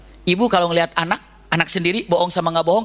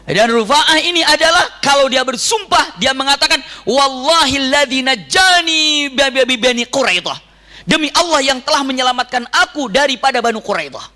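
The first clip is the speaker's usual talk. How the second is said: loud, slow declaiming; preaching; 7 semitones higher, 4 dB louder than usual. Acted. Preacher solemnly declaims Rufa'ah's oath. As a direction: proclaim the oath with weight.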